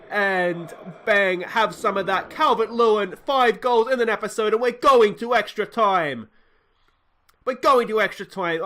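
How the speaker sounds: loud, high, buzzing with joy; thrilled celebration. Real, not acted. Gamer cheering his team into extra time, thrilled and loud. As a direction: celebrate loudly.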